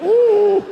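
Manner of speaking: high whining "ooh" of a sad dog; pitiful dog whimper. Retold or imitated. Imitating the sad dog in the charity ad whimpering. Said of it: "he's like".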